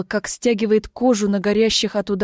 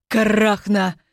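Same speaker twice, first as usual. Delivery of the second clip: hissing; slower than usual. A narrator's reading. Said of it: "зашипела принцесса мне в лицо".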